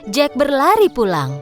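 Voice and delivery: ordinary delivery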